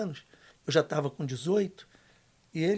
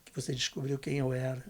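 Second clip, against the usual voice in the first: father's soft confession; 6 semitones lower, 10 dB quieter than usual. Retold or imitated. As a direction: quietly voice the father asking forgiveness. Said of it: "ele olhou dentro do meu olho e falou assim". (confissão baixa do pai, em voz contida)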